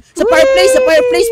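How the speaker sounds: high, loud excited call; excited shout. Real, not acted. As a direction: shout it out, thrilled. Spotting hiders, excited calling. (sabik na sigaw)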